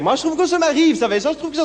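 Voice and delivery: chatty neighbour in a high voice; squeaky neighbour voice. Acted. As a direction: pitch it way up, like a fussy neighbour. Neighbour voiced to Monsieur Gilbert.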